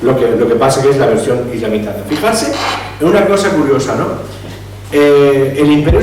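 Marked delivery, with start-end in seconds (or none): none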